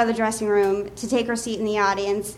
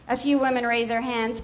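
ordinary talk

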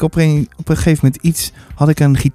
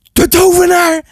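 put-on high, startled child's cry; alarmed boy; 12 semitones higher than usual. Boy spots the wizard.

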